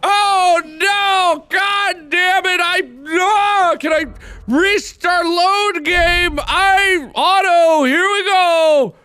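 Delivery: high, drawn-out cry of dismay; frustrated wailing shout. Real, not acted. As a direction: wail in anguish at a game gone wrong. Missed something while gaming, yelling in frustration.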